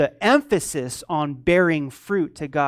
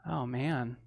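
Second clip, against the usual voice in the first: ordinary talk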